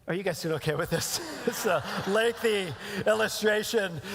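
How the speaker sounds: real chuckle through the words; amused, self-aware laugh while talking; laughing aside. Real, not acted. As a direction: check in with the audience, laughing at yourself. Amused aside about his long illustration.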